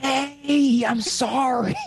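put-on high, drawn-out drunken sorry; drunk apology. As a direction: imitate a sheepish drunk dad. Acting drunk dad apologizing.

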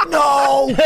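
high, loud mock wail of horror; waking to a missed flight; retold panicked cry. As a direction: cry out as if you overslept. Woke and saw missed flight.

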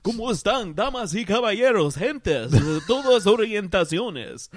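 booming Spanish ring announcer; lucha libre announcer. Acted. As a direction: announce it like a wrestling MC. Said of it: "who knew Dracula was Salvi".

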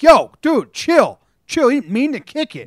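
calming shout. Retold or imitated. Player in the clubhouse calling off an angry teammate, loud. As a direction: shout down the angry guy, step in fast. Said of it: "So you're like".

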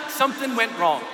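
ordinary talk, nothing marked

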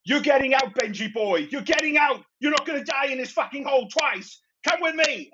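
high, frantic in-character yell; life-or-death yelling. Acted. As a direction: yell it high, fighting to drag someone to safety. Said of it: "it's just a life or death struggle".

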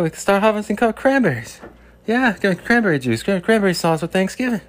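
hammed-up Bill Burr, high and loud; Bill Burr impression. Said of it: "Everyone likes my Bill Burr impression".

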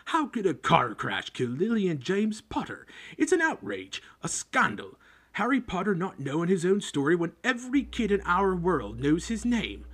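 loud, high, furious protest; indignant outrage. Acted. Hagrid to the Dursleys, loud indignant outrage. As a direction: rage at the injustice of it.

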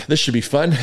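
usual speaking voice

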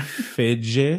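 "Feige" said fay-ZHAY, drawn out; mock-French pronunciation. Co-host jokes about the name, "Feige" as fay-ZHAY. Jokey alternative pronunciation offered.

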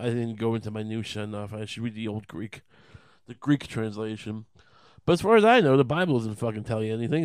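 ordinary talk

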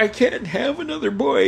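high, pleading dread; imitated despairing mother. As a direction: wail it lightly as a desperate mom. Mother dreads another son.

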